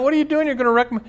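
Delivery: put-on very high child's cry of protest; hammed-up boy protesting the stone throwing; upset little boy. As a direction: wail like a boy whose toy is being hit. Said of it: "The little boy starts crying".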